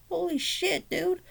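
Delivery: very high, soft mock squeak of shock; retold surprise. Recalling Chucky coming to life as a kid, high soft mock surprise. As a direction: squeak it softly, way up high, imitating childhood surprise. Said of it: "I was surprised. I was like".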